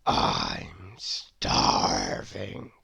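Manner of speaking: very low, very slow rumbling growl; growling lion. A narrator's reading. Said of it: "growled the lion".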